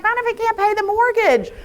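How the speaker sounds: lightly mimicked fretting, pitched high; a touch of mock panic, voice lifted; worried husband. Husband fretting in his head, lightly mocked worry. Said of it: "In his head, he's like".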